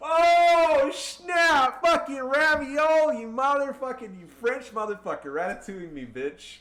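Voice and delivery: high, loud mocking taunts, fading down; gloating shout. Real, not acted. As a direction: taunt the beaten enemy with mock French insults. Gloating after double wipe.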